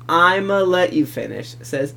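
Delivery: ordinary talk, nothing marked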